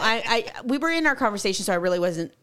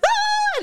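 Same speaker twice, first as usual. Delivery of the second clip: a piercing high mock shriek; retold scream; 3 dB louder than usual. Woman retelling the fly bite to her friend, shrieks high. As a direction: shriek as if the fly just bit. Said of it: "And I'm like".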